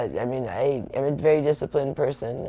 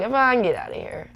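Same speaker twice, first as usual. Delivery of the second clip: playful dismissal; 9 semitones higher than usual. Real, not acted. Scolding commenter Yvonne.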